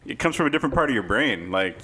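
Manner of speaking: ordinary talk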